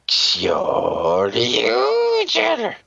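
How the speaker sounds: slow, drawn-out title call; dramatic announcer. Acted. Host naming the show, slow announcer call. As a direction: announce the show name like a hype man.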